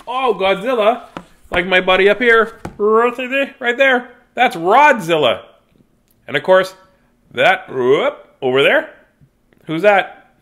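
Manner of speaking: loud, high, delighted showing off; a burst of delight at the toy; excited. Real, not acted. Excitedly shows his stuffed toy.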